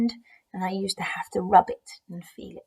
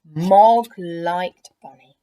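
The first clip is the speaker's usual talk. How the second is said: stretched out slowly and loud; drawn-out, loud storyteller line; slow emphatic; 11 dB louder, much slower than usual. A narrator's reading. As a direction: savour each word, slowly.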